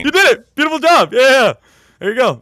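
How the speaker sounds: high, loud delighted praise; excited cheering. Real, not acted. Co-host celebrates a learner's success, excited and loud. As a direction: cheer them on, pitch shooting up.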